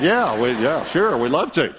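hammed-up eager yes; eager acceptance. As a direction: jump at the free meal. Broke couple eagerly accepting pizza.

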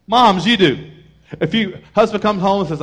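usual speaking voice

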